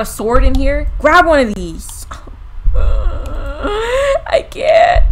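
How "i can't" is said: much higher breathy crying shout; laugh-cry in fear; overwhelmed; scared laughing cry. Real, not acted.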